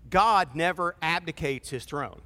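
ordinary delivery

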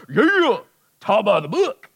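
high, loud mock sneer; mock bookish churchgoer. Congregants who hear the motto, high mocking voice. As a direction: play the know-it-all literalist. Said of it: "some of you are like".

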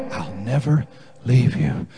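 low, soft, tender promise; God's reassurance. Acted. God's reply to each confession.

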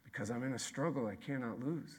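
soft, hushed conviction; softly spoken promise; quiet refrain. Real, not acted. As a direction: drop way down and say it gently. Quiet refrain after shouting.

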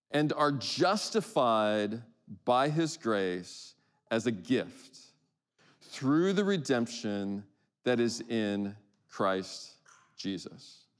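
slow, spaced scripture reading; read slowly. Shown as a demonstration. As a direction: let each phrase soak in. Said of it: "I'm gonna read this slowly".

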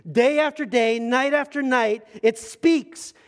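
loud, high, drawn-out sermon delivery; full-force preaching. Acted. Preacher proclaiming the psalm about the skies, full-force preaching.

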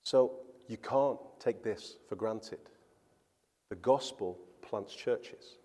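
normal talking voice

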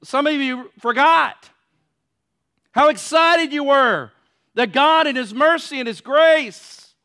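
loud, high, impassioned plea to God; preaching at full force. Acted. Preacher pleading before the congregation, loud and fervent.

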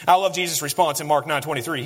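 normal talking voice

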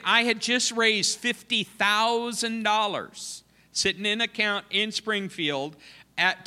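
ordinary talk, nothing marked

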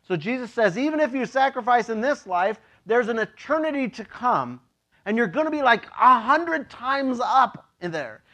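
ordinary talk

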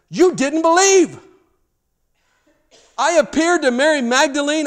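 loud, high, scolding Jesus, pounding each accusation; Jesus rebuking. Acted. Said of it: "we see him rebuking them".

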